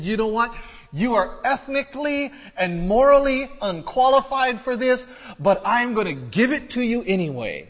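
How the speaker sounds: high, loud, emphatic proclamation; God declaring grace. Retold or imitated. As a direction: preach it as God's bold announcement. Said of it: "And he said".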